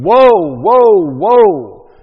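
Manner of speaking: booming, high, drawn-out cries of woe; eagle's warning cry. Acted. Preacher voicing the eagle over the earth, loud warning cry. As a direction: call it out like a herald from the sky. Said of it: "this eagle flying through the air saying".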